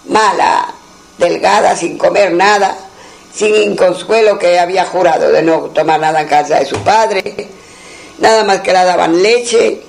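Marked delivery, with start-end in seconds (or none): none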